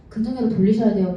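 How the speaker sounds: ordinary talk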